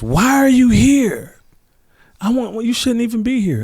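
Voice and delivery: a daughter snapping at her dad in front of friends, mimicked high; daughter's yell. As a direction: imitate an embarrassed teen yelling at her dad. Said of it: "and yells".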